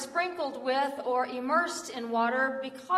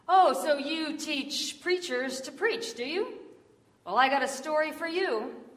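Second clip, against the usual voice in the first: higher, hearty storyteller's voice; raised, folksy eager voice; talkative stranger; 6 semitones higher than usual. Retold or imitated. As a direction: buttonhole the listener with keen interest. Stranger reacts to preaching teacher.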